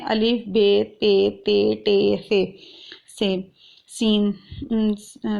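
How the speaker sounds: الف بے تے ٹے سے in Urdu letter names. Shown as a demonstration. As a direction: recite the Urdu alphabet, slowly. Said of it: "Urdu میں ہم کیا پڑھتے ہیں".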